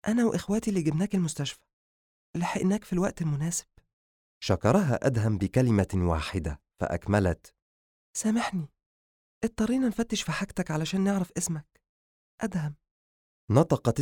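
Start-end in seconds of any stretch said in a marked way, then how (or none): 0.1-3.7 high-voiced female character; female doctor's voice. 8.2-12.8 high, gentle apologetic woman; high-pitched, sorry female character; female doctor apologizing. Acted.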